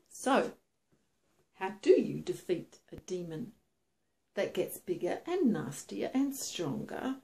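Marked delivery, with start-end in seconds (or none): none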